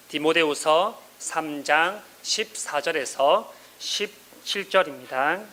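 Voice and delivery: usual speaking voice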